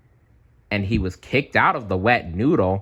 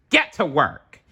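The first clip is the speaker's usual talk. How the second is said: ordering manager; 7 semitones higher, 3 dB louder than usual. A narrator's reading. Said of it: "the manager ordered".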